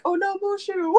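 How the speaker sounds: high alarmed yelp; alarmed cry. Retold or imitated. Panicked cry to the cat.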